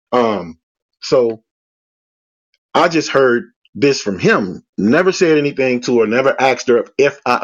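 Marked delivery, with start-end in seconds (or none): none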